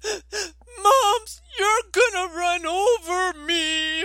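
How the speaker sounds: put-on high, loud wailing little girl; hammed-up child's crying wail; imitated bawling. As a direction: mimic a small child sobbing in terror, drawn out. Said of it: "she's bawling and she says".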